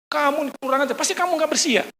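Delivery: high-pitched retold scolding of a recruit; officer berating the cadet over his dirty rifle, mock angry; scolding commander.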